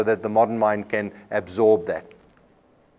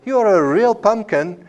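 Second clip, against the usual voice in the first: sweet and much higher on You; higher affectionate tone; 8 semitones higher than usual. Shown as a demonstration. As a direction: say it tenderly, pitched up high. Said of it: "my voice in different tones".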